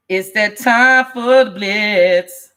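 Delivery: very slow, emphatic announcer call; drawn-out announcement. Real, not acted. Host announces the blitz call to viewers, drawn-out announcement. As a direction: stretch every key word like a ring announcer.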